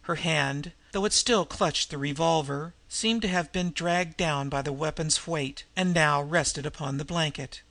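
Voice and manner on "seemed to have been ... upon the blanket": normal talking voice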